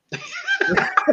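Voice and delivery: a high burst of laughter. Real, not acted. Laughing at the banter.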